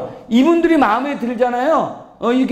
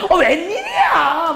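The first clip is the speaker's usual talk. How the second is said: high, stretched mock squeal; gushing sociable type; 7 semitones higher, slower than usual. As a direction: imitate an over-the-top sociable reaction. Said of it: "막 이런 분들". (호들갑 떠는 사교형 흉내)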